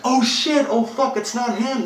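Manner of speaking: high-pitched, alarmed outburst; panicked shriek. Retold or imitated. Woman realising they grabbed the wrong man, panicked. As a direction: blurt it out in mock panic.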